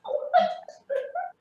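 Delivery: high-pitched burst of laughter. Real, not acted. Woman amused by how she changes her speech, high laugh. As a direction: laugh out high, almost squealing.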